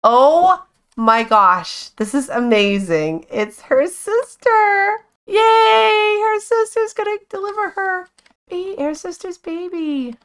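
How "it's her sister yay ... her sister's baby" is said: high, slow squealing delight; excited. Real, not acted. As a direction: squeal with joy. Thrilled that the sister delivers.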